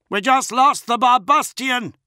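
high, loud alarmed report; yelling. A narrator's reading. Crewman reporting a lost ship, yelling. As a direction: yell the loss across the bridge. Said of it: "someone yelled".

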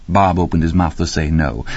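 usual speaking voice

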